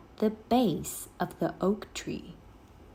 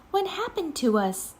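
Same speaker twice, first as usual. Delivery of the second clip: put-on higher, quicker girl's voice, bewildered; bewildered Annie; 9 semitones higher, faster than usual. A narrator's reading. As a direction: Annie asks Jack what happened, bewildered girl voice.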